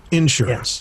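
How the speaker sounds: "insurance" stressed IN-surance; Southern insurance. Shown as a demonstration. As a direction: punch the opening IN of "insurance". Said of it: "In the South".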